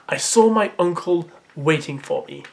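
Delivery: ordinary talk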